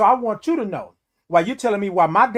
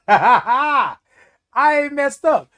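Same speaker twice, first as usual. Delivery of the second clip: laughing; 6 semitones higher, 5 dB louder, much slower than usual. Real, not acted. Laughs into line.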